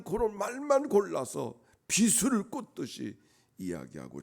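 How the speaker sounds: high, loud, slow drawn-out emphasis; forceful preaching. Real, not acted. Preacher describes enemies' piercing words, forceful preaching. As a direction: stretch the key words with rising force.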